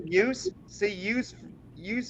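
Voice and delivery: yous echoed with a high questioning rise. Retold or imitated. Coworker asking in surprise about her word yous. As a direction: check the word yous, rising. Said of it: "did you just say".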